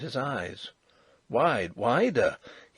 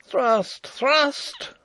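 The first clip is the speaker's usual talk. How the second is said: very high, loud fighting shout; battle cry; 7 dB louder than usual. A narrator's reading. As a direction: yell it as you lunge. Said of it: "cried Rupert again".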